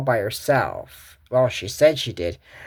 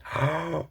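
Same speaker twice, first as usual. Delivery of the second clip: sharp gasp; 7 dB quieter than usual. Real, not acted. Gasp at the find.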